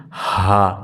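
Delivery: "হা" said low and long, from deep down; deep-sounding haa. Shown as a demonstration. Teacher shows students the letter sound, deep "হা". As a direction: give "হা" a deep, drawn-out open sound. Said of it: "গম্ভীর আওয়াজ হবে".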